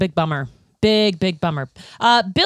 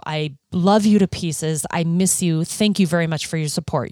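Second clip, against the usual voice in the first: normal talking voice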